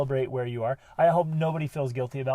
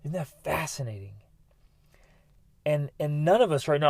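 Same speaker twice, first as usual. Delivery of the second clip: ordinary talk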